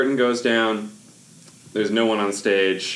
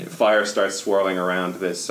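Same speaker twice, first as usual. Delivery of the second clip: ordinary talk, nothing marked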